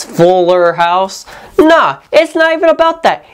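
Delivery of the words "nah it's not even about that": high snide "Nah"; high mocking brush-off; mocking retort. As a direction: sneer it back, much higher. Mocking the show's answer.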